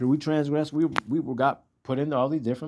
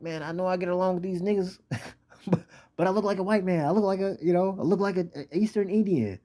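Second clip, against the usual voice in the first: higher-pitched, pleading voice of a conflicted man; identity-crisis complainer; 7 semitones higher, faster than usual. Acted. Confused man explaining he looks like other nations, higher-pitched protest. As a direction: play him higher, torn about who he is.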